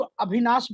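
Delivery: "अभिनाश" with bh for v and s at the end; Bihari-accented "अभिनाश"; Bihari pronunciation. Shown as a demonstration. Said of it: "शायद उसको अभिनाश बुलाएंगे".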